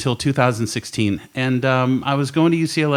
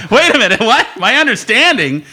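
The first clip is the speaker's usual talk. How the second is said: high, louder and laughing; half-laughing shocked double take; mock panic at the doctor's wording; alarmed disbelief; 10 semitones higher, 7 dB louder than usual.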